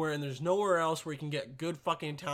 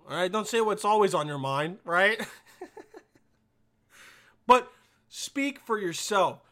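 normal talking voice

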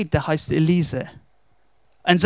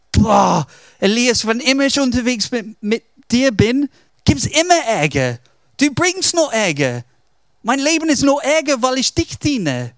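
higher-pitched exasperated complaint; accusing, despairing; 5 semitones higher than usual. Retold or imitated. Servant blames Elias for all his trouble, exasperated accusation. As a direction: blame your master in mock despair. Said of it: "Er klagt ihm an".

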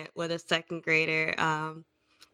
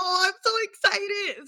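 much higher, gushing; mock excited; 10 semitones higher than usual. Teacher telling the kid she can't wait, high and gushing. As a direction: play up the thrill.

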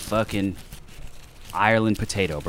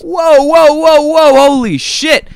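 high, loud yelps of alarm; panicked yelps; 13 semitones higher, 8 dB louder, slower than usual. Retold or imitated. On the toilet as it stretches, high panicked whoa. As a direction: yelp it out as the pain hits.

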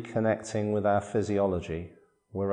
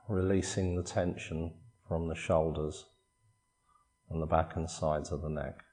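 soft, unhurried body-scan voice with long pauses; guided meditation; 5 dB quieter than usual. Acted. Meditation teacher guiding listeners through a body scan, calm guided meditation. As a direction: calmly guide them through relaxing, phrase by phrase.

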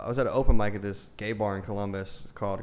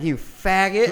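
put-on high, loud drunken insult; drunk girl yelling; 10 semitones higher, 7 dB louder, much slower than usual. Drunk girl yelling at the big guy. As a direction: spit it out like a drunk woman screaming. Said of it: "she starts yelling".

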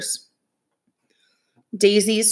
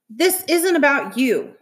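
higher, drawn-out scolding; mild yell; 7 semitones higher than usual. A narrator's reading. Sister scolding her brother, mildly yelled. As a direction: raise the pitch in a restrained yell. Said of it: "she yelled".

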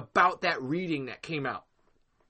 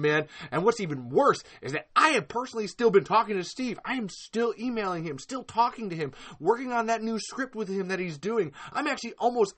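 ordinary talk, nothing marked

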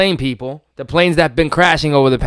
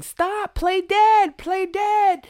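high mock plea, pitched way up; imitating her panic; 13 semitones higher, much slower than usual. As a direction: squeal it like a woman watching in terror. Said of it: "you hear her saying".

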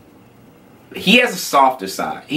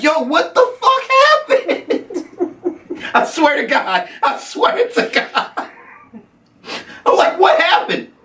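laughing disbelief; slower than usual. Retold or imitated.

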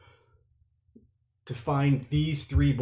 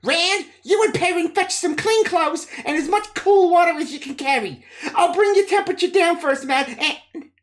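much higher, brisk commanding woman; Nynaeve's voice; 5 dB louder than usual. Acted. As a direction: give quick firm orders, pitched up. Nynaeve gives orders.

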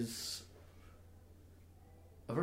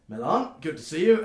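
loud, raised-pitch hearty greeting; Burden's greeting; 6 semitones higher, 11 dB louder than usual. Acted. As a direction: hail an old colleague loudly. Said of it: "Burden goes".

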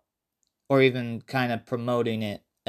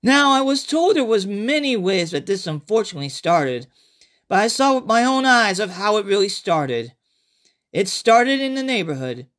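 higher, brighter-pitched storyteller voice greeting the listener; character narrator; 9 semitones higher than usual. Acted. Survivor greeting listeners before his flashback story. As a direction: play a survivor telling his tale.

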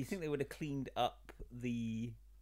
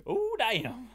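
hillbilly voice; 11 semitones higher, 7 dB louder than usual. Pretend. Hillbilly bit continues.

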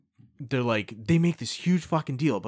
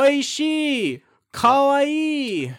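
high squealed Japanese reactions; mock TV gushing; 12 semitones higher, 6 dB louder, much slower than usual. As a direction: squeal like a TV tasting host.

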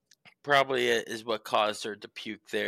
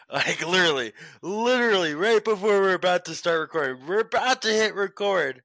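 rising higher and louder in disbelief; exasperated buildup; 8 semitones higher, 6 dB louder than usual. Retold or imitated. Dog owner tells co-host about bad timing, voice climbing higher and louder. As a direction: build the outrage line by line.

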